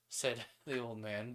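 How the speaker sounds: ordinary delivery, nothing marked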